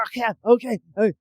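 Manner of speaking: loud strangled plea; high, choked-out surrender; choking. Retold or imitated. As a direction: mimic choked words while being hit. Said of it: "the dude who's choking".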